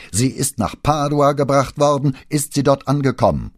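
loud, high, insistent demand; urgent interruption. A narrator's reading. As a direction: cut in forcefully over the boss. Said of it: "unterbrach ihn Brunetti".

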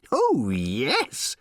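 high, ecstatic little robot squeal. A narrator's reading. Little robot answering Ford, squealing with joy. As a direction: squeal it with swooping delight. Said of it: "squealed the little robot".

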